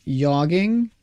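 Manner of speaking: "jogging" said as "yogging", rising at the end; Swedish J-as-Y. Shown as a demonstration. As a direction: start "jogging" with a Y sound, questioning. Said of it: "a Swedish person would have a very difficult time differentiating".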